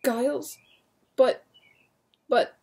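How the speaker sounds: breathless astonished stammer. Acted. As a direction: stammer in disbelief. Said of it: "William stammered breathlessly".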